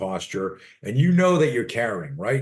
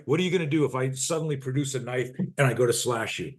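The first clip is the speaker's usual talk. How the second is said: normal talking voice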